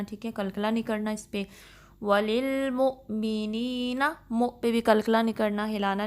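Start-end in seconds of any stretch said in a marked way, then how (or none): 2.0-4.0 وللمؤمنین recited slowly, the lam kept light and steady; calm tajweed reading of وللمؤمنین; steady recitation. Shown as a demonstration. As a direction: draw out وللمؤمنین with no bounce on mu. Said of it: "لام جو ہے اس کو ہلانا نہیں ہے".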